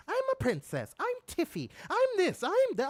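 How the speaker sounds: high, prissy mocking voice; hammed-up mock of a self-important starlet; mocking princess voice. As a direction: mimic a spoiled princess. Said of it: "you sound like modern women".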